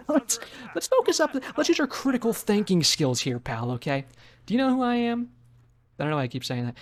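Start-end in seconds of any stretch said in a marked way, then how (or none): 0.1-5.3 mock lecture.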